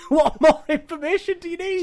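laughing, high and loud. Real, not acted. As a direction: laugh it out in disbelief. Mocking partner's reading.

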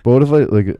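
very loud, mouth on the mic; talking into the mic. Pretend. Co-host joking about doing the whole show this way, booming on the mic. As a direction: blast the words into the mic for a joke. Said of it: "I'm just talking like this".

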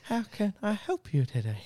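high fake-polite phone voice; mock phone voice. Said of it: "they're just".

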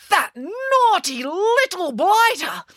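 high, drawn-out outraged yell; slow, high cry of outrage. A narrator's reading. Mum rushing to the screen door after a thief, outraged yell. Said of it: "Mum suddenly started yelling".

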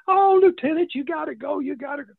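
high, eager coaxing voices; excited secretaries. Retold or imitated. Secretaries pushing the lieutenant to go, high and eager. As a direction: egg him on like giggling secretaries.

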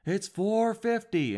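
high, slow, drawn-out reply; lifeguard's deadpan answer. Retold or imitated. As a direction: say it slowly like a tired lifeguard. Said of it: "he goes".

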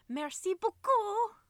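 high, loud, drawn-out mock merci; over-the-top happy tourist French; gleeful French thanks. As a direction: sing out French thanks, stretching the end. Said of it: "you were so happy there".

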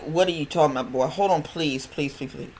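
ordinary delivery

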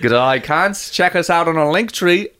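put-on Aussie plug; mock Aussie promo; Australian accent. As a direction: read the link like an Australian. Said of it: "can you do letters in Australian".

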